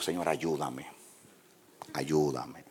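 soft whispered-down plea to God; hushed prayer. Retold or imitated. Pastor at customs praying to God, soft plea. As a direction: pray under your breath.

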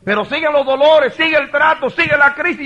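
high, loud mounting testimony; full-force preaching. Real, not acted. Pastor telling the congregation the crisis goes on, full-force preaching. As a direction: drive each clause home at a shout.

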